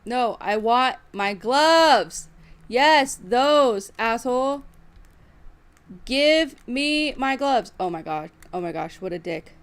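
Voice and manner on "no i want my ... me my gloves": loud, slow, spaced-out demand; insisting a teammate give back the gloves, loudly; angry demand. Real, not acted. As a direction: drag each word out, fuming.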